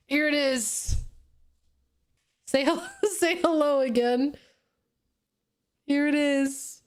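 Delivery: giggly show-and-tell; playful high offering. Real, not acted. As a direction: present the cat with a grin. Offering cat to Austin.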